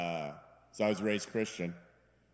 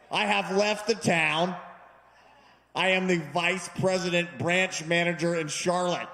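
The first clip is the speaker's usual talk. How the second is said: haughty friend; 7 semitones higher, 5 dB louder than usual. Retold or imitated. Old friend on the phone, lofty put-down. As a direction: look down on the caller.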